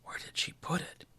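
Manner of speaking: soft unvoiced whisper to himself; murmured whisper. A narrator's reading. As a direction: whisper it, groping in the dark. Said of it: "he murmured".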